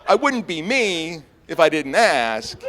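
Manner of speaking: ordinary talk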